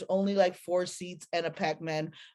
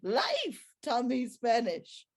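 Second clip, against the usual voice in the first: sassy retort; 6 semitones higher than usual. Retold or imitated. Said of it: "I was like".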